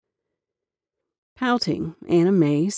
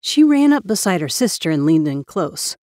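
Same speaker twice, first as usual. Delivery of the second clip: ordinary delivery, nothing marked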